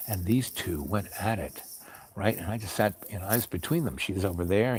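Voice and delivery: usual speaking voice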